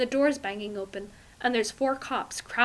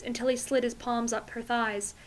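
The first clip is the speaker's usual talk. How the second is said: plain reading voice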